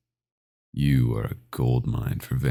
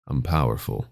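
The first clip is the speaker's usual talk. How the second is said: ordinary talk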